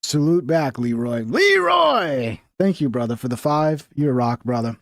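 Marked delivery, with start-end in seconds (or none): 1.2-2.4 high sung-out name call. Real, not acted. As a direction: call his name out with a flourish. Calling out the donor's name.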